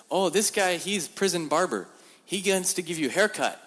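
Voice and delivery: high, loud cheerful explaining; bright high voice for the laughing prisoner; amused prisoner. Retold or imitated. Thai prisoner explaining the barber to the visitor, high and cheery.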